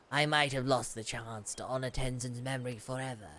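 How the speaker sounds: low, grave character voice; deep, measured dramatic read; solemn game character. Acted. Reads game character's dialogue.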